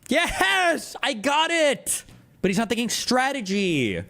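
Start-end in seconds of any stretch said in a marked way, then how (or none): 0.1-2.0 high, drawn-out triumphant cry; mock triumph. Streamer acting out a leader who looks good on TV, mock triumph. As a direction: crow it high, stretching the words.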